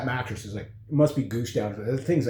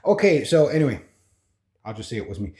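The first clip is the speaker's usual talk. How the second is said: usual speaking voice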